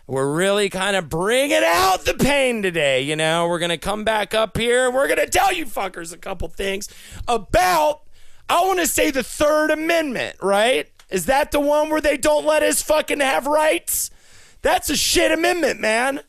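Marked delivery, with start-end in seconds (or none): 4.9-16.2 angry rant. Acted. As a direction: work yourself into a furious rant at the crowd. Ranting at audience, escalating.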